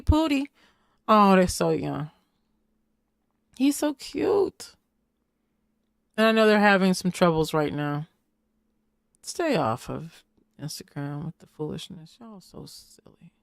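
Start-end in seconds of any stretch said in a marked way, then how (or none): 3.6-4.7 cooing. Real, not acted. Cooing over the baby. 9.2-13.3 hushed, breathy scold; whispered scolding. Real, not acted. Scolding aside about Instagram.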